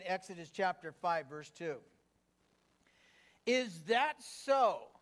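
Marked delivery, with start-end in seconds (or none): 3.5-4.9 slow, higher-pitched challenge; challenging retort. Acted. Pharaoh answering Moses and Aaron, a slow retort. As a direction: stretch each word into a pointed retort. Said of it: "retorted Pharaoh".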